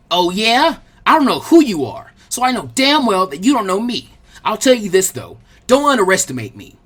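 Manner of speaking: loud, heated defiance; angry challenge. Acted. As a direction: fire back at her taunt. Naruto furious challenge.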